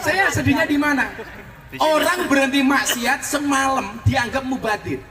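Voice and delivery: high, forceful rhetorical protest; indignant preaching. Real, not acted. Preacher disputing that one night without sin is wasted, indignant. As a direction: challenge the critic before the whole crowd.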